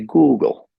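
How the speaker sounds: "Google" with GOO hit loudly; first-syllable stress. Shown as a demonstration. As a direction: punch GOO, let the end of "Google" fall. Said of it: "the first one is stressed".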